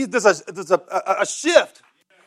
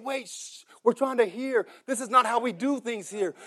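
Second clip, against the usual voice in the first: hushing; 3 semitones higher, 10 dB quieter than usual. Retold or imitated. Church folk hushing the blind man.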